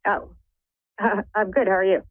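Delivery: ordinary talk, nothing marked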